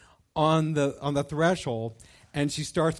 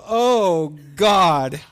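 alarmed cry; 3 semitones higher, 11 dB louder than usual. Retold or imitated. Watching mom roll onto the floor, a loud alarmed cry. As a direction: shout it as she drops.

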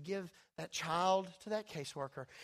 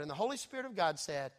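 usual speaking voice

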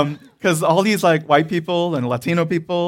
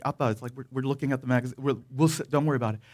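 softer, hushed plea to dad; muttered reassurance; 3 semitones lower, 8 dB quieter, much faster than usual. Retold or imitated. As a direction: quietly brush dad off so nobody hears. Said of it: "We're like".

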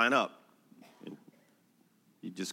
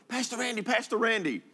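put-on much higher little boy's voice, calling out; calling child; 11 semitones higher than usual. Pastor imitating a neighborhood boy calling his name, high child voice. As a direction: call after the pastor like an eager eight-year-old.

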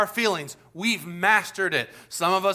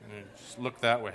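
low, soft, self-conscious reply; imitated awkward, subdued teen; embarrassed teenager; 9 semitones lower, 6 dB quieter than usual. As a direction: mumble it to shoo a staring kid away. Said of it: "And I was all embarrassed".

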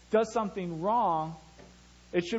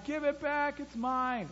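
put-on crying child; 9 semitones higher, slower than usual. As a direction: whimper it like a toddler who lost his toy. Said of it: "Caleb crying".

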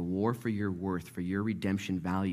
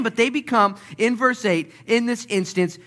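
loud, high, driving sermon delivery; full-force preaching; 13 semitones higher, 7 dB louder than usual. Acted. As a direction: preach it out, building fast.

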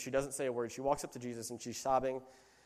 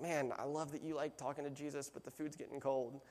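softly voiced grumble of a waiting guest; hushed imitation of an impatient guest; quiet mock complaint; 9 dB quieter than usual. Voices a hungry guest during long prayer.

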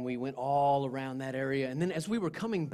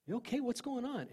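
high, softened voice of alarm; worried questioning; 9 semitones higher, 4 dB quieter than usual. Retold or imitated. Checking on the friend crying over there, worried questioning.

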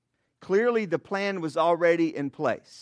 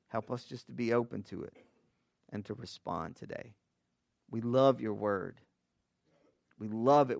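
prayer; 3 semitones lower than usual. Acted. Prays before the sermon.

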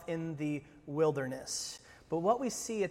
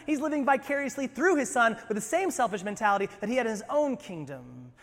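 ordinary talk

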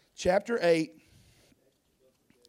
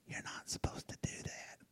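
soft, voiceless whisper of conscience; whispered voice of the Holy Spirit; 18 dB quieter than usual. Acted. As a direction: breathe it like a small inner voice. Holy Spirit quietly convicting.

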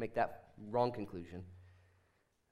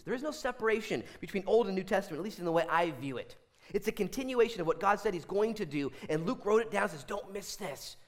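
raised, high-pitched pulpit delivery; fervent preaching; 8 semitones higher, 4 dB louder than usual. Real, not acted. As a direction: press the point home with fervor.

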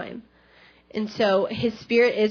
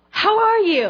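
high, loud gushing hello; thrilled retold greeting, much higher and louder; excited greeting; 12 semitones higher, 7 dB louder than usual. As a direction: greet like an overjoyed friend, pitch leaping up. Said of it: "so excited and just talking to me".

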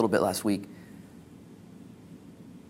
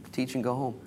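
usual speaking voice

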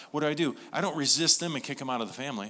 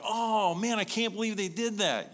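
shocked binge watcher; 9 semitones higher than usual. Retold or imitated. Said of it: "every time I'm like".

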